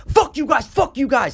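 high, loud imitation of a friend going nuts; screaming. Friend screaming at the other golfers, going nuts. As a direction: go nuts at them, pitch way up. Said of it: "then Dan starts screaming".

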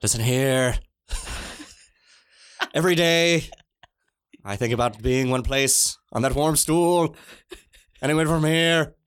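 lightly voiced ghostly Littlefinger; a hint of a ghostly Littlefinger. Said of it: "some ghostly Littlefinger voice".

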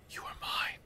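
eerie unvoiced whisper. A narrator's reading. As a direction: breathe it like a ghost. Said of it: "you heard a voice say".

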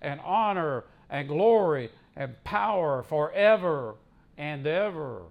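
slow, ringing proclamation of praise; scripture declamation. Acted. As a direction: draw out each word of praise with swelling emphasis.

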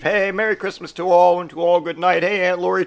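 high, sing-out holiday hello; hammed-up cheery greeting. Greeting Aunt Lori on Christmas Eve, hammed-up cheer. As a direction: call it out with mock innocent cheer.

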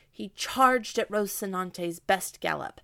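ordinary delivery

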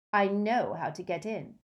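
ordinary delivery, nothing marked

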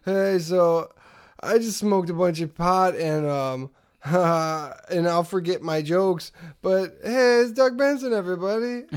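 stoned comic greeting, drawn-out chuckle; Doug Benson. Acted. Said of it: "Doug Benson walks out and goes".

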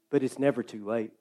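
low and soft, almost to yourself; quiet aside. Acted. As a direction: murmur it as a gentle truth. Repeated line, two takes.

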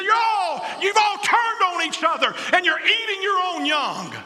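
high, loud indignant shout; Jesus shouting. Acted. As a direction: shout out the charge against them. Jesus rebukes the church.

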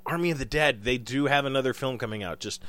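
usual speaking voice

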